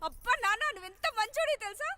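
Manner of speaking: high, loud burst of joy; butter up dad excitedly; delighted gushing. Acted. Delighted by father's promised gift.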